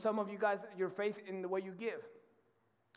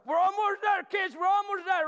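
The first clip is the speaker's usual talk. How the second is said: high, loud cheering call down to the crowd; loud yell from above; 14 dB louder than usual. Retold or imitated. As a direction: shout encouragement as you dig. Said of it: "you hear Bob was like".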